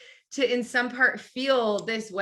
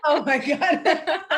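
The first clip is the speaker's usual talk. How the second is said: slow, louder laughing oh my God; laughing exclamation; 7 semitones higher, 4 dB louder, slower than usual. Real, not acted. Coach reacting to client's worry about her English, laughing. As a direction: laugh it out, touched and amused.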